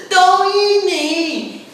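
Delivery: high, loud, slow scream of fear; terrified shout. Acted. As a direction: beg not to be eaten. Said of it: "he shouted in terror".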